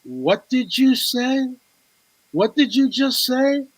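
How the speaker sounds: as if he can't believe his ears; stunned disbelief. Retold or imitated. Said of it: "and I go".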